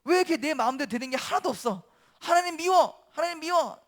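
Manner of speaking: huffy complaint building to a high cry; acted anger. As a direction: protest like a sulky prophet. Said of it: "또 화가 나죠".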